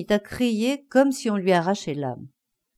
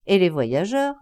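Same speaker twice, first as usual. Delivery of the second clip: ordinary delivery, nothing marked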